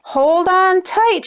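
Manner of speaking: called out high and slow over the tractor; shouted. A narrator's reading. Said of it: "shouted Paw Paw".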